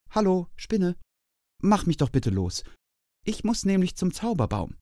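a tiny hare asking nicely; little hare. A narrator's reading. Little hare asks spider politely.

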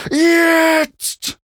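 high, loud, long-held scream. A narrator's reading. Mount screams a third time while racing down, straining scream. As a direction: hold it out as a high battle cry. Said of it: "schrie Mac".

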